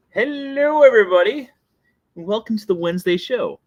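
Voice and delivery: booming high hello; host greeting. Acted. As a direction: greet the audience big. Loud show host opening.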